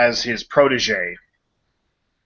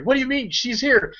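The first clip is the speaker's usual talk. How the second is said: panicked Terry; 11 semitones higher than usual. Retold or imitated. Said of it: "Terry's freaking out".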